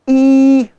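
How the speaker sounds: a long, loud demo ई; teacher sounding out the vowel. Shown as a demonstration. Teacher demonstrating a vowel to students, loud and drawn. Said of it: "देखो कितना फर्क है".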